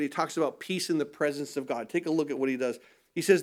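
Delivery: ordinary talk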